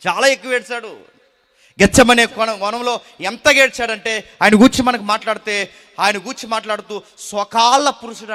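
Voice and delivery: loud, high, fervent preaching; full-force preaching. Acted. Preacher thundering to the congregation about fear, loud and fervent. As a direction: drive the point home to the congregation.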